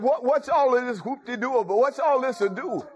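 high-pitched, eager questioning; excited blind man. Acted. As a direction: ask like you must know what the commotion is. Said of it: "Blind man got excited".

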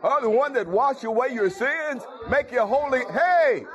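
high, breathless-with-wonder questioning; excited blind man. Acted. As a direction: call to others eagerly, voice riding high. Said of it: "Blind man got excited".

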